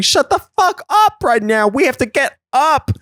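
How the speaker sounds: high, strained mock yelling; scolding inner voice. Brain talking to itself in the water, high frantic scolding. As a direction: act out a panicking brain ordering itself around. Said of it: "your brain is also going".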